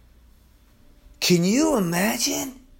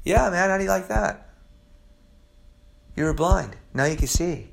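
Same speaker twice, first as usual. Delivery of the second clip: easygoing, softer laid-back voice; calm; 5 dB quieter than usual. Acted. Jesus to the man he has just healed, calm. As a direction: say it coolly, with no hype at all. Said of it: "he's probably calm, and he's just like".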